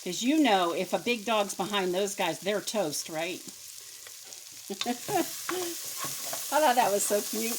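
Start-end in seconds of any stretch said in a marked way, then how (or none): 3.5-6.5 high airy giggle; a silent wheezing laugh; breathless giggle. Real, not acted. Cook cracking up at her own joke, breathless giggle.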